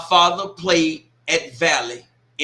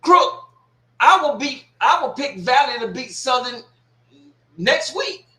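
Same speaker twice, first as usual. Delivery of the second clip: called out loud and high at the troll; loud challenge; 6 semitones higher, 3 dB louder than usual. Real, not acted. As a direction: throw the bet back at him, loud. Shouting challenge at troll commenter.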